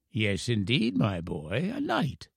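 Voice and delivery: put-on soft elderly voice, playfully solemn; confirming old professor. A narrator's reading. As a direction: assure the boy with quiet pride. Professor confirms his knighthood to boy.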